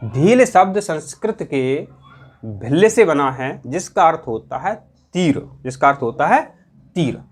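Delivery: usual speaking voice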